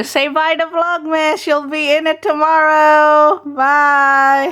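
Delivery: high, loud, sing-song call; calling out brightly so they join in; cheerful calling out. Real, not acted. Urging someone to wave bye to the camera, in a loud cheerful call.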